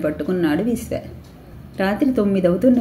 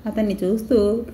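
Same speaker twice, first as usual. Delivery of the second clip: plain reading voice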